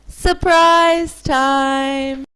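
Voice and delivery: drawn-out announcer. Acted. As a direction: stretch out the show title. Show title called out.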